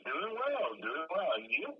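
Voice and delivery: muffled, faint caller audio; bad connection. Real, not acted. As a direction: voice through a bad phone line. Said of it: "It's very difficult to hear you".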